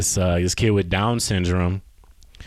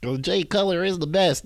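mocking quote; 9 semitones higher than usual. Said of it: "Y'all think I wanna be".

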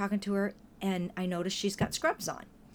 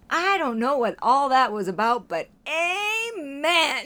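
louder, drawn-out amen breaking into laughter; laughing amen; 6 semitones higher, 7 dB louder, slower than usual. Retold or imitated. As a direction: ham up the stranger's bemused amen. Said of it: "he looks over at us and he goes".

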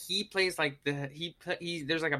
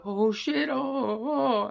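higher, nervous, stammering "oh"; a lightly voiced awkward young guy; Michael Cera impression; 9 semitones higher, much slower than usual. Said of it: "like, Michael Cera kind".

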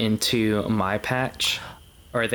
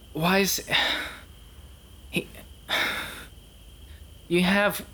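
high-pitched, halting exasperation; fed-up lecture with long stunned pauses; exasperated; 7 semitones higher than usual. Acted. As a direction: scold a hopeless underling, struggling for words. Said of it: "put a hand over my eyes".

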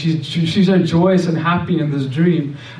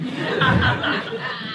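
laugh; 8 semitones higher, 6 dB quieter than usual. Real, not acted. Laughs at his own nerve.

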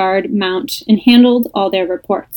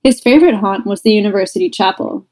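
ordinary delivery

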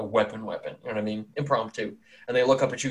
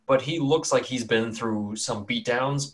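ordinary talk

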